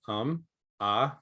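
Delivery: hesitant um and uh; filler sounds. Shown as a demonstration. Trainer showing filler sounds to avoid, hesitant um and uh. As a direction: drop in filler sounds like an unsure speaker. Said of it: "you gotta watch for these words of".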